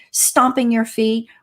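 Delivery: ordinary talk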